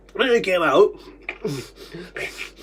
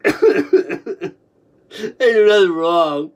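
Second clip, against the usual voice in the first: usual speaking voice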